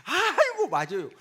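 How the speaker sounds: breathy sigh, then high coaxing agreement; fawning hostess. Acted. Hostess agreeing with whatever the customer says, exaggerated fawning. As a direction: gush along with an imitated hostess's coo. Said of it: "여자들이 그럽니다".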